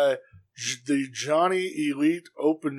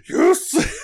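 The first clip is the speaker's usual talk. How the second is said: high, loud shout of joy; ecstatic yes; 6 dB louder than usual. Retold or imitated. Fan retelling his own joyful yell at the TV. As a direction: pop like a fan in the crowd.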